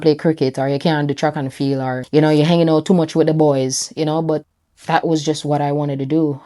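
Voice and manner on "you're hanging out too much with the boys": normal talking voice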